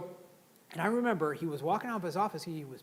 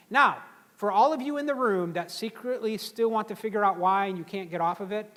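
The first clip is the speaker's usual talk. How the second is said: usual speaking voice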